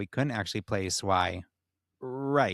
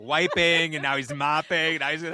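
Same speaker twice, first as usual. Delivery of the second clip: much higher and louder, giddy commentary; excited riffing; 8 semitones higher, 5 dB louder than usual. Real, not acted. Joking with cohosts about the cleaning scene, giddy and excited. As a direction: pile on the joke with rising glee.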